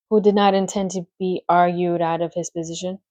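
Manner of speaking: plain reading voice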